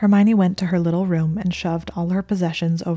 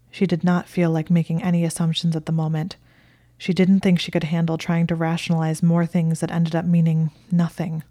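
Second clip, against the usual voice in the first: usual speaking voice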